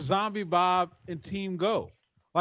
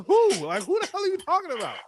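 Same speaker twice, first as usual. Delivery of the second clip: high-pitched, quick incredulous demand; retold disbelief, pitched way up; exasperated confusion; 11 semitones higher, 4 dB louder than usual. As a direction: squawk it in mock bafflement. Retells his baffled reply to Paul.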